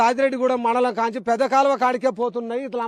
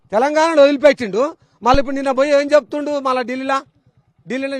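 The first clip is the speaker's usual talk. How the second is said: loud, higher and fast, spitting out the scorn; furious rant; 4 semitones higher, 6 dB louder than usual. Real, not acted. Farmer denouncing the chief minister to the reporter, in a loud furious rant. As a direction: rail at him with rising fury.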